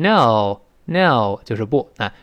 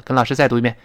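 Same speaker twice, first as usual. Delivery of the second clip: ordinary talk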